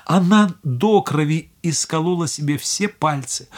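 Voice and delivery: ordinary delivery, nothing marked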